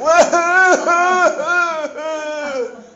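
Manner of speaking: a burst of high laughter. Real, not acted. Preacher laughing high after his own loud wail.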